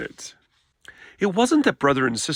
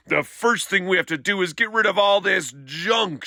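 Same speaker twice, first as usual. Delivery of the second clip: lost temper; 6 semitones higher than usual. Acted. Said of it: "she said".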